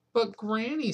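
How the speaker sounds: put-on high, small child's plea; a little boy protesting to granny; small boy pleading. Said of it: "said Patrick".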